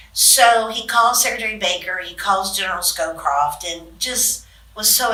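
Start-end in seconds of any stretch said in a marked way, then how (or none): none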